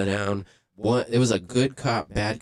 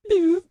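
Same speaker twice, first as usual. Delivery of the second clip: a very high, loud beep; mock beep noise; 7 dB louder than usual. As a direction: squeak out a sharp electronic beep. Imitating a siren or alarm noise.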